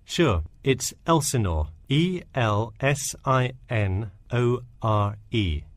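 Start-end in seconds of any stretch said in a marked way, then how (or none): 1.9-5.7 slow, letter by letter; spelling it out. Acted. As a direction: spell each letter out clearly and slowly. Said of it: "Could you spell that for me?".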